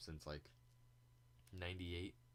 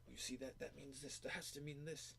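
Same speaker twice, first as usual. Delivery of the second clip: high-pitched mock conspiracy video-maker; imitating an eager YouTube theorist, pitched way up; excited video people; 9 semitones higher than usual. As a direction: point out clues in a much higher mocking voice. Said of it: "video people are like".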